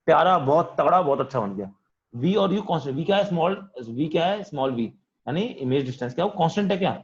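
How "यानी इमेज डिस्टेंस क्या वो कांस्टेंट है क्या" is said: ordinary delivery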